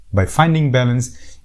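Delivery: ordinary talk, nothing marked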